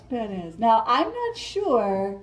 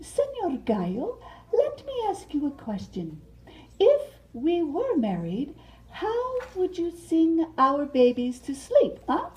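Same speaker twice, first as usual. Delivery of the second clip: raised, sweet butterfly voice; Mariposa voice; 6 semitones higher than usual. Acted. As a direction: play the flirty butterfly asking her suitor. Mariposa voice, held role.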